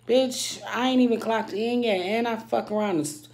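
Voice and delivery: loud, high, fed-up yell; angry outburst. Real, not acted. Ranting to viewers about being rushed before clocking in, loud and angry. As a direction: blow up at the whole morning.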